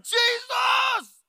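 shouted very high and loud; joyful shout. Pretend. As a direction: cry out in amazed praise. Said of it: "he's like".

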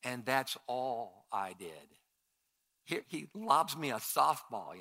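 normal talking voice

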